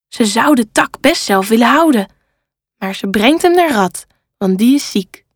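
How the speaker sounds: ordinary delivery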